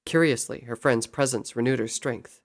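ordinary delivery

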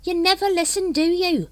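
high, loud irritated snap; snapping. A narrator's reading. Brother Watchtower scolding another brother, snappy. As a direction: snap it out, pitched right up. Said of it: "snapped Brother Watchtower".

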